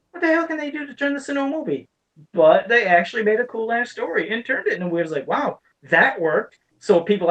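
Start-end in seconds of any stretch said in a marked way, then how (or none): 0.1-1.9 incredulous. Real, not acted. Incredulous about Rampage movie.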